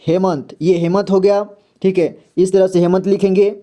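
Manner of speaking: normal talking voice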